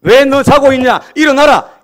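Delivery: loud, high rebuke; scolding shout. Acted. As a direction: bark it at him like an angry God. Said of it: "이렇게 얘기하지 않고". (누워 있는 엘리야를 꾸짖는 하나님의 높고 큰 고함)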